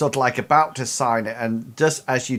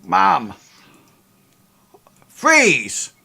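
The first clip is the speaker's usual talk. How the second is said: guard shouting freeze; 5 semitones higher, 4 dB louder than usual. Acted. Guard appears and orders freeze.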